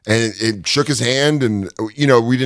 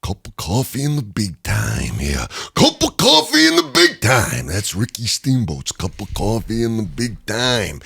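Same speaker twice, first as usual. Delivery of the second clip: Macho Man impression; 3 dB quieter, slower than usual. Doing a Macho Man promo about Steamboat, raspy and slow. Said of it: "they're both Steamboat promos".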